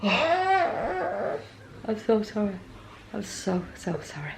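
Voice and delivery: slow, sighing, fading apology; drained and worn out, sighing; exhausted sigh. Real, not acted. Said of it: "I'm absolutely shattered".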